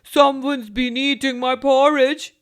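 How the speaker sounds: big booming bear, loud; loud gruff Father Bear. A narrator's reading. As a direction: boom it out like a huge papa bear. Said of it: "said in a very loud voice".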